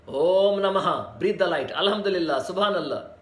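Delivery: ordinary talk, nothing marked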